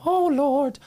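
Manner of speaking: put-on high, fluttery old lady; a friend imitating an old lady fussing over the boys. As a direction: coo like a doting grandma. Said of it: "like, old lady".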